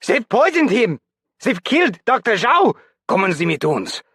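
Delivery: very high, loud German shriek; very high, loud panicked German shriek. A narrator's reading.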